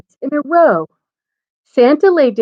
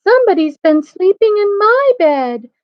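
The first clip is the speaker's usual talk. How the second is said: high-pitched Mama Bear; middle-sized voice; 11 semitones higher, 4 dB louder than usual. A narrator's reading. Mama Bear finds her bed slept in, middle-sized high voice. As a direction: lift it into a high mother bear voice. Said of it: "in her middle-sized voice".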